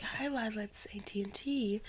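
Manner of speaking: breathy spooky whisper of the caller; creepy whisper. Retold or imitated. Telling the ghost story, she whispers the caller's creepy greeting. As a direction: hush it like a creepy prank caller. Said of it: "they're like".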